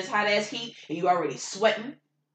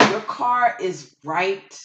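ordinary talk, nothing marked

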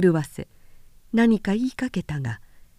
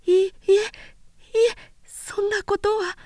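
stammering in panic; 12 semitones higher than usual. Acted. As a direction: stumble over the words in fear. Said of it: "激しく頭を振りながらどもった".

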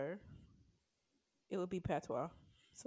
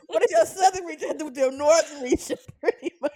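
laughing question; 6 semitones higher, 13 dB louder, faster than usual. Real, not acted.

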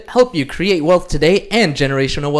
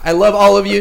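usual speaking voice

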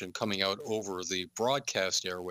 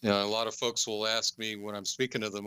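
ordinary talk

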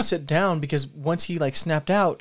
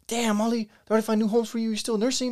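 higher, lightly voiced pity for the puppy; mock sympathetic; 8 semitones higher, faster than usual. Talking to puppy Ollie, higher mock pity. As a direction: coo at the abandoned puppy. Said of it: "And I was like".

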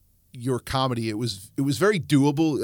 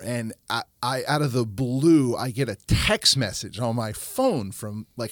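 ordinary talk, nothing marked

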